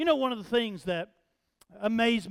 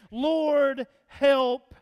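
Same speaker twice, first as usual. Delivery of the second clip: loud, raised, drawn-out prayer; emphatic plea; 3 semitones higher, 4 dB louder than usual. Retold or imitated. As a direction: call out the plea word by word. Repeating the woman's prayer.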